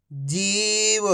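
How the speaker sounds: whole word in one go, vowels held; syllables joined. Shown as a demonstration. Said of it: "तो मिलके बन जाएगा".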